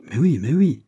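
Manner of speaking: loud, impatient cry of the duke; impatient exclamation. A narrator's reading. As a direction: brush it aside, impatient to get on. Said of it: "s'écria le duc avec impatience". (s'écrie avec impatience, plus fort)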